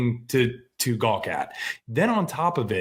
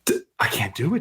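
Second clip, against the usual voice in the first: usual speaking voice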